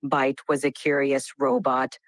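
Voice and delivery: low flat robot voice; dramatic robot voice, no word stress. Shown as a demonstration. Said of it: "can you do this in a robotic voice now?".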